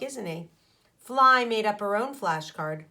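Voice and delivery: usual speaking voice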